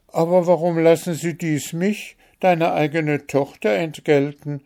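high, plaintive daughter's plea; tearful protest. A narrator's reading. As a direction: protest as if close to tears, without sobbing. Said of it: "weinte Märchen".

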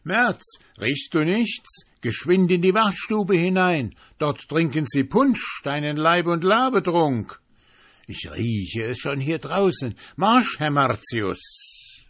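teasing trickster. Acted. April fooling March about punch, teasing. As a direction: lure him with mock excitement.

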